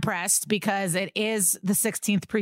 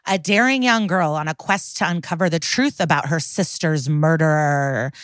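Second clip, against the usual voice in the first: punchy, louder promo voice; louder ad read; 3 semitones lower, 6 dB louder than usual. Acted. Host selling a mystery game to listeners, loud promo read. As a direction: pitch the game with dramatic flair.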